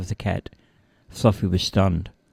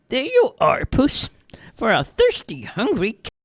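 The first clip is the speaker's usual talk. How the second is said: put-on high-pitched old-woman voice; old lady; 14 semitones higher than usual. A narrator's reading. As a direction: present the bowl as a doting granny. Old lady voiced, feeding the cat.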